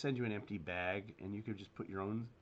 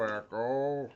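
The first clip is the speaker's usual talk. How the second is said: cave echo; 7 dB louder than usual. Shown as a demonstration. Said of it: "Oh, I can hear echo".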